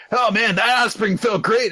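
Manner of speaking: high-pitched enthusiastic impression; put-on peppy high voice; excited boy. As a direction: crow about it in a high voice. Acting enthusiastic Asta.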